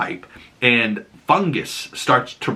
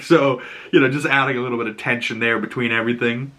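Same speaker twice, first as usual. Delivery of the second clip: normal talking voice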